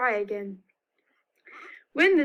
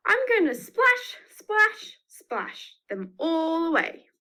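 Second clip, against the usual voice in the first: God, splashing with theatrical glee, slower at the end; big God voice; 6 semitones higher than usual. Acted. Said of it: "God said to Noah".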